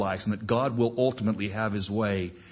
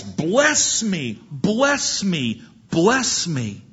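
higher, acted cry repeated insistently; crying plea; 6 semitones higher, 3 dB louder, much slower than usual. Son begging his father to bless him, acted cry. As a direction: plead as if wailing to your father. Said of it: "he cries".

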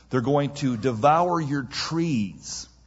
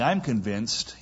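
ordinary talk

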